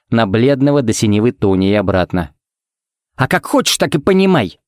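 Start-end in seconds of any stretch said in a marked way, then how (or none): 3.2-4.6 higher-pitched, huffy comeback; huffy woman's higher voice; snorting retort. A narrator's reading. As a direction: toss it back with a snort of irritation. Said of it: "фыркнула Пепси".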